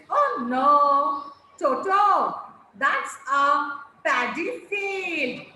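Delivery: loud Oh no, then slow, clear that's a paddy field; teacher showing a child how to read the exclamation; expressive reading. Shown as a demonstration. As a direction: read it aloud with feeling and pauses. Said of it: "Read it again like this".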